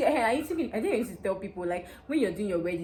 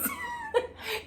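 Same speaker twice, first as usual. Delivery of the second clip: high laughing sob; 4 dB quieter than usual. Real, not acted. Laugh-cry.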